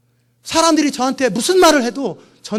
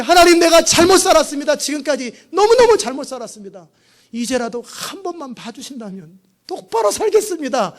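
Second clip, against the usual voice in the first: pleading repentance; 5 semitones higher, 5 dB louder than usual. Acted. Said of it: "이걸 통회라고 그래요".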